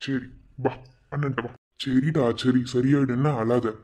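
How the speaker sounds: put-on calm the crying girl gently; comforting. Brother soothes his crying sister.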